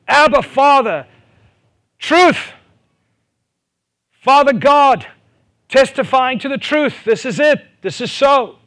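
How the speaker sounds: high, loud preacher's cry; fervent preaching. Acted. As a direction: preach it at full force. Preaching climax, fervent crying out.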